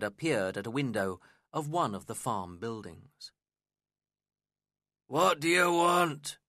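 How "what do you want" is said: a slow, loud growl, pitched up. A narrator's reading. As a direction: snarl it at the stranger. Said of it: "he growled".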